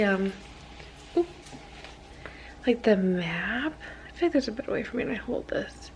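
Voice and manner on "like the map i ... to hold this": usual speaking voice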